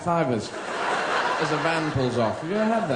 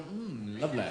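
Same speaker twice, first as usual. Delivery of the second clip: tasting pet food; 9 dB quieter than usual. Acted. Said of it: "Do humans go".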